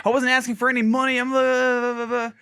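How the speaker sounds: high, quick imitated protest; mock yelling. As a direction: rattle off his indignant excuse, dissolving into filler. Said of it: "yelling, like".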